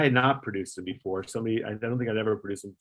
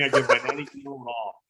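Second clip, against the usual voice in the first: laughing; 9 semitones higher than usual. Real, not acted. Frantic search, laughing.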